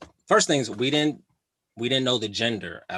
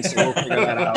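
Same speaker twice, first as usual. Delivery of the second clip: laughing; 6 semitones higher, 4 dB louder than usual. Real, not acted. Breathy laugh at his joke.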